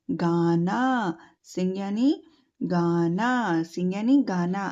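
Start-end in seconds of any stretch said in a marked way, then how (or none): 0.1-1.2 slow drawn-out answer. Shown as a demonstration. Teacher giving the Hindi meaning slowly to children. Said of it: "सिंग यानी क्या होता है?". 2.6-3.6 slow drawn-out answer. Shown as a demonstration. Teacher giving the Hindi meaning slowly to children. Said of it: "सिंग यानी क्या होता है?".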